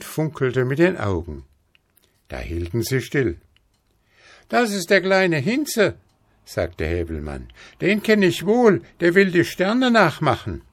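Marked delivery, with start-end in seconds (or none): 4.5-5.9 put-on high-pitched cocky child; Häwelmann. A narrator's reading. 7.8-10.6 put-on high-pitched cocky child; Häwelmann. A narrator's reading.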